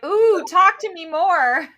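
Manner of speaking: high, slow, breathy-sounding swoon; swooning fan. Retold or imitated. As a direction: melt over a British voice. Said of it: "Americans were like".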